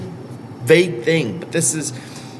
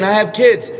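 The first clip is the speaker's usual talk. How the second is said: imitated parent excuse; 8 semitones higher, 3 dB louder than usual. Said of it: "You might be saying".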